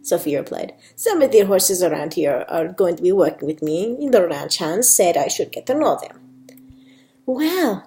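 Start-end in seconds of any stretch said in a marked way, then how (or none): 0.9-6.2 put-on Spanish accent; amateur Spanish accent, lilting. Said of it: "Sophia's got a bit of a Spanish accent". 7.3-7.8 higher, mildly amazed wow; a touch of awe; exclaiming. A narrator's reading. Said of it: "Tina exclaimed".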